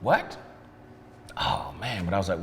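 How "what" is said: sharp high retold shock; a jolted, disbelieving what; mock alarmed what. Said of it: "And I'm like".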